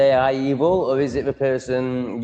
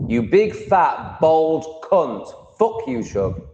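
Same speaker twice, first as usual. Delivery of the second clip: drawn-out, raised mock insult; slowed mock insult; 6 semitones higher, much slower than usual. Mock-angry slow insult thrown at viewer Shug. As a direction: stretch out every word of the insult.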